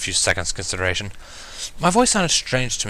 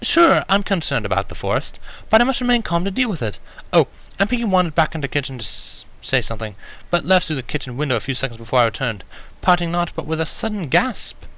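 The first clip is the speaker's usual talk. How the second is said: soft-spoken Rarity; 7 semitones higher than usual. A narrator's reading. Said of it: "Rarity spoke softly".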